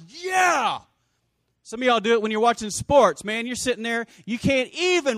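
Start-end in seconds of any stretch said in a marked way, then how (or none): 0.1-0.8 high, loud cheer; shouted yeah. Shown as a demonstration. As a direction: cheer like you just got good news. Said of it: "you're like".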